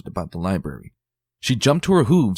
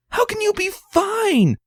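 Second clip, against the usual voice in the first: dramatic fussing lady; 4 dB louder than usual. Acted. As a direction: gush over her friend's ordeal. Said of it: "Rarity echoed dramatically".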